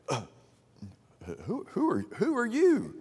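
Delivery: high, hushed "who are you?" as the shaken Saul; startled question. Acted. Saul's reply to the voice.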